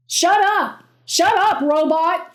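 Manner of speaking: frantic shout at a robot voice; screamed. A narrator's reading. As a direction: scream it at the dashboard. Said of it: "Kevin screamed at the dashboard".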